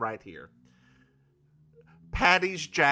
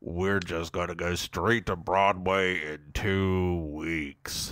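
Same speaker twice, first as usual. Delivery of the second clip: low Barry voice; 9 semitones lower than usual. Pretend.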